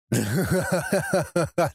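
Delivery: gloating villain chuckle; evil laugh. Acted. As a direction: laugh at the fool's fate. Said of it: "засмеялся Люцифер".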